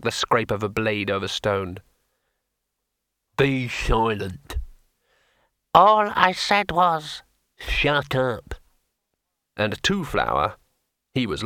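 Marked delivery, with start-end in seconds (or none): 3.4-4.6 slow, stern command; clipped order from a hero; stern warrior. A narrator's reading. Hrun answers the sword. 5.7-8.5 slow, higher, louder peevish retort; sulky talking blade; peevish sword. A narrator's reading.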